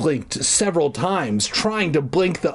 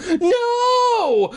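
very high, wailing "no"; hammed-up high moan of despair; 3 dB louder than usual. A narrator's reading. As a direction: wail it out melodramatically. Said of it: "I moaned".

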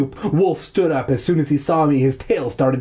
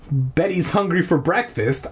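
ordinary delivery, nothing marked

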